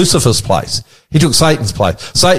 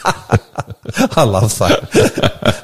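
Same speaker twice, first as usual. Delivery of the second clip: real amused laugh through the words; 5 semitones lower, 3 dB quieter, much slower than usual. Real, not acted. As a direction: say it while breaking up laughing. Laughing at banter about being English.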